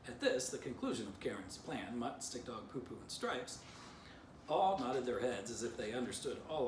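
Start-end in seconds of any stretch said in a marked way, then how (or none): none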